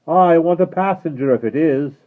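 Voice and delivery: loud high call from afar; distant call. Acted. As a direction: call across the dark. Rider calling back.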